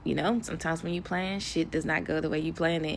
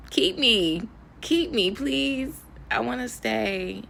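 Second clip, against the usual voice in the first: higher-pitched, playful begging; pleading; 7 semitones higher, slower than usual. Pretend. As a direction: plead to be allowed to stay. Playfully pleading to stay in Thailand.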